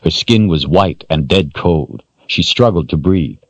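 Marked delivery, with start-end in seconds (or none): none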